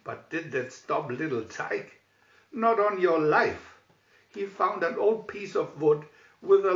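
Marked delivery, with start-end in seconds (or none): none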